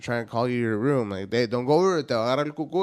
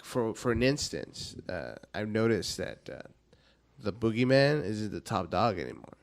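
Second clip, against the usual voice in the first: ordinary delivery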